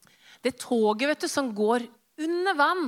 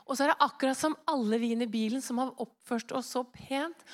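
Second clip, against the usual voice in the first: usual speaking voice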